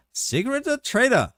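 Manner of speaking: high, slow mock Vietnamese accent. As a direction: imitate a friend's Vietnamese accent. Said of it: "doing his Vietnamese accent".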